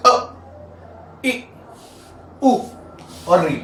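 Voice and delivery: अ, इ, उ, री each cut very short, one by one; short vowels. Shown as a demonstration. Teacher demonstrating how little time these vowels take, short vowels. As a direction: say अ, इ, उ, री as quick separate beats. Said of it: "इसमें बहुत कम समय लग रहा है".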